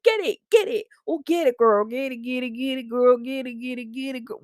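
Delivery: high, bouncy cheerleader voice mocking a fitness influencer; mock influencer hype. Mocking a social media influencer to her listeners, high hyped chant. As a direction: ham up the pushy influencer chant. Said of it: "they said to you".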